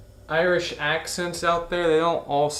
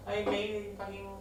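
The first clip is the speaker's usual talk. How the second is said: Irish accent; 7 semitones higher, 9 dB quieter than usual. Shown as a demonstration. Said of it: "things sounding Irish".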